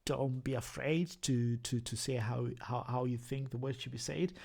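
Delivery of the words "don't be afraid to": ordinary talk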